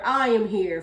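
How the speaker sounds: loud, booming anime hero catchphrase; All Might. Retold or imitated. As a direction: lightly proclaim it like a superhero. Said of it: "from All Might".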